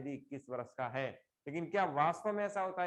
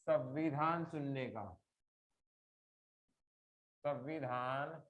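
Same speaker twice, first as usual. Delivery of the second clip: slow, measured dictation with long pauses; 4 semitones lower, slower than usual. Real, not acted. Teacher dictating a sentence to the class, slow dictation. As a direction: read it out slowly, phrase by phrase.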